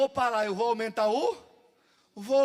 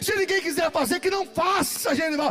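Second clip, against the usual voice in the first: fervent preacher over music; preaching; 3 semitones higher, 4 dB louder than usual. Acted. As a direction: urge the church on, full force.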